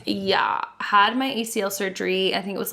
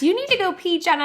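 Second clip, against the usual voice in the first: higher mock mother-in-law; a light impression of a mother-in-law, higher pitched; mother-in-law's voice; 8 semitones higher, faster than usual. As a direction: imitate the mother-in-law telling her to pee. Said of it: "she goes".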